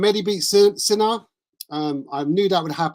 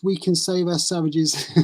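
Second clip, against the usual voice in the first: ordinary talk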